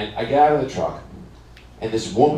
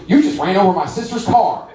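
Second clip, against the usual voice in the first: higher-pitched mock indignation; accusing sister; 6 semitones higher than usual. Sister walks in on the driver, raised indignant mock voice. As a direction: confront him as the outraged sister. Second woman quoted accusing him.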